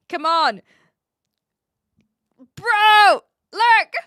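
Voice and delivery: bro, look at this right now; loud excited call. Real, not acted. Urging viewer to see photo.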